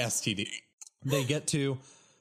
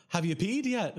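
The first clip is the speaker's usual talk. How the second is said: high doctor voice; 9 semitones higher than usual. Retold or imitated. Doctor's question retold.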